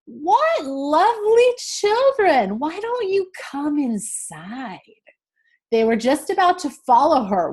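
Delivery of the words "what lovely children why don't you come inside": put-on high, slow, sugary old witch luring children; sweet witch voice. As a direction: coax them in like a fairy-tale witch. Witch voiced in read-aloud story.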